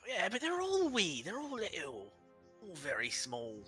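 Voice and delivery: high, dopey giant agreeing; a dim brute insisting; another giant. Acted.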